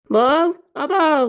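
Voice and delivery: slow sing-out summons to someone out of sight; calling out. Acted. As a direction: call for your wife, stretching each word. Calling out for his wife.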